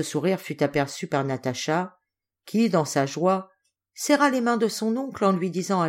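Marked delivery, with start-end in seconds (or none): none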